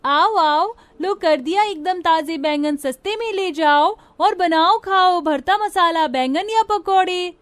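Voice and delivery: high, loud market hawking; loud sing-out sales patter; street vendor's call. Acted. Girls hawking brinjals in market.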